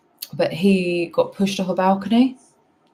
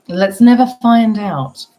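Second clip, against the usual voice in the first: mock ominous; 5 semitones higher, 10 dB louder, slower than usual. Joking aside to chat.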